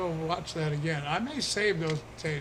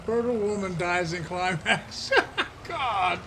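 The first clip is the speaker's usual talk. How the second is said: laughing; 3 semitones higher, 4 dB louder than usual. Real, not acted. Joke read while laughing.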